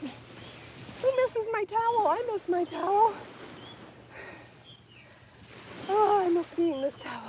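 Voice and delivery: high soft cooing voice; playful baby voice. Pretend. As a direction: coo at the towel lovingly. Playful talk about towel.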